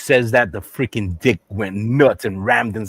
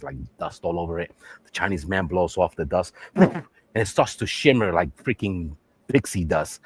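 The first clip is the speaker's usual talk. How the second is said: ordinary talk, nothing marked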